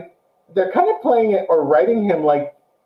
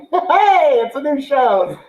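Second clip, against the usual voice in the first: high, laughing "hey"; laughing cheer; 10 semitones higher, 4 dB louder, slower than usual. Real, not acted. Host wrapping up the show review, laughing cheer. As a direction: cheer it through a laugh, pitched up.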